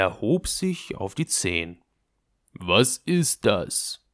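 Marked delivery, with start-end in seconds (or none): 2.5-4.0 entrüsteter Kaiser. A narrator's reading. Kaiser ertappt die Küssenden.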